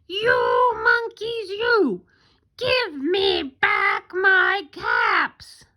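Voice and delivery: high, drawn-out mock-angry scolding; angry peddler. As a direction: scold slowly, stretching each word for the children. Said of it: "this made the peddler angry".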